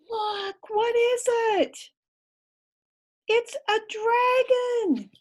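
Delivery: high, amazed reveal. Real, not acted. Reader showing the child the dragon, amazed. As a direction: point it out wide-eyed.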